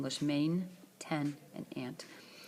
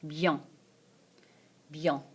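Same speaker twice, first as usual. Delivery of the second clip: normal talking voice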